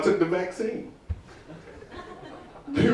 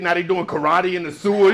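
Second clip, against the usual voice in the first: usual speaking voice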